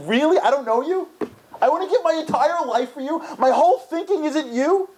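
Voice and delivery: hurt parent who cannot believe it; mock wounded outrage; incredulous parents. Parents answer the child.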